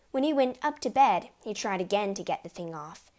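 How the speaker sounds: ordinary delivery, nothing marked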